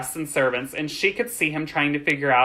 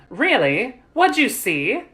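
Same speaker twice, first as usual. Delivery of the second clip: higher, eager questioning; full of curiosity; 8 semitones higher, 3 dB louder, slower than usual. A narrator's reading. Young master asks girl what she saw, curious. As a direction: ask with keen, lifted curiosity. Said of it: "full of curiosity".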